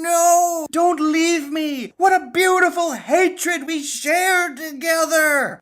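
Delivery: hammed-up high, loud wailing; slow, drawn-out desperate pleading; panicked scream. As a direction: scream it in mock utter panic. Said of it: "just screaming in utter panic".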